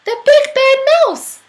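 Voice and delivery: high, very loud scream; child yelling in fright at the shadow; terrified yell. Acted. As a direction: shriek in terror. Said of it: "yelled the Gruffalo's child".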